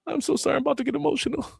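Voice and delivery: very high, squeaky pre-cry apology; hammed-up high voice, about to get emotional; mock tearful apology. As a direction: imitate someone apologizing before they cry. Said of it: "apologizing for crying before we cry".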